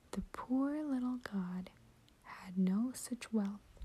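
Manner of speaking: usual speaking voice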